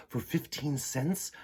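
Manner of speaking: ordinary delivery, nothing marked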